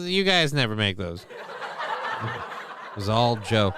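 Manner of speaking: sarcastic, laughing through words. Real, not acted. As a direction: chuckle out the sarcasm. Sarcastic jab at politicians.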